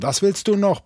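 sharp, loud and high bark into the phone. Acted. Furious economist barking at his caller. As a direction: snap it at the caller like an angry dog. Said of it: "bellte er".

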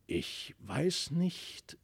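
soft, slow, puzzled murmur; troubled murmur. A narrator's reading. Father confiding his unease to his wife, softly. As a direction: wonder aloud, uneasy and soft. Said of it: "sprach der Herr Thaddäus von Brakel".

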